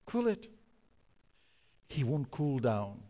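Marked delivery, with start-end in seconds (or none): 0.0-0.5 higher, clipped command from the pilot; a light imitation of the captain ordering calm; pilot's order. Captain orders the angry passenger to cool it, lightly imitated. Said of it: "the pilot comes in and says".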